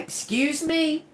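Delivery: high, slow, indignant; slow affronted excuse me. Retold or imitated. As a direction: drag it out, offended. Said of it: "And I was like".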